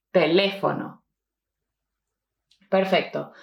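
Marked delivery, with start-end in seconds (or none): 0.1-1.0 "teléfono" with LÉ stressed and a low falling end. Shown as a demonstration. Teacher asking learners to repeat, "teléfono" stressed on LÉ. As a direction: model "teléfono" with the weight on LÉ. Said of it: "repeat after me".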